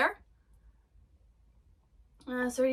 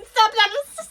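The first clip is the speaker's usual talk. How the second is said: mock pleading yell; 7 semitones higher, 6 dB louder than usual. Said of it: "they're like".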